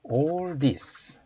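deliberate, drawn-out words; slow model reading. Shown as a demonstration. Teacher introducing the next phrase, slow model reading. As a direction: say each word slowly for learners.

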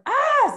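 high, loud scared "ah"; startled yell. Acted. Napping cat startled by a boo, scared yell. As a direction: yelp in sudden fright, high and loud. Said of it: "Zed yelled. He was scared".

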